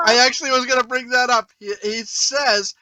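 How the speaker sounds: usual speaking voice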